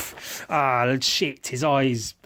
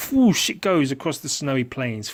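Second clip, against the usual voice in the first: ordinary talk, nothing marked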